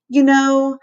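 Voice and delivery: put-on high, teasing kid voice; kid hollering. A narrator's reading. Kid calling out to the lunchroom, hollering. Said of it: "that kid might holler".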